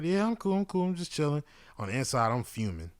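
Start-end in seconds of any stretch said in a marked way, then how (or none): 0.0-1.4 light, breezy and high, hiding anger; forced easy-going reply; fake casual calm. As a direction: brush it off like nothing's wrong. Faking calm while fuming inside.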